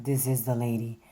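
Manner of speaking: lightly sultry, low lady voice; a hint of a sultry lady voice; sultry deep voice. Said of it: "grown and sexy voice".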